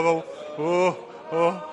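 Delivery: loud startled oh's while losing balance; mock panic cries on the rope; wobbly gasps. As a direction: gasp like you're tipping off a tightrope. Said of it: "wobbling on pretend tightrope".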